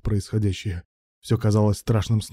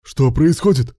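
loud, raised question; panicked exclamation; 4 semitones higher, 6 dB louder, slower than usual. A narrator's reading. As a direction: blurt it out in alarm. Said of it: "в панике воскликнул Руслан".

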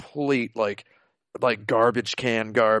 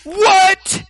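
much higher and louder mock schoolgirl yell; schoolgirl shout; 7 dB louder than usual. Schoolgirl threatening Ryo, hammed-up high yell. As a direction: screech it like the game's angry girl. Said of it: "she goes".

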